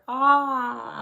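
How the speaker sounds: high, loud, drawn-out mock moan of pleasure; mock delighted sigh. Wife imitating husband tasting her jollof, mock delighted sigh. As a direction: imitate someone savouring great food.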